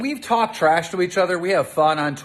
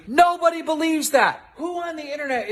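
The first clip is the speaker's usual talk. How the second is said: angry exclamation; 9 semitones higher than usual. Real, not acted. Keemstar ranting at Trisha.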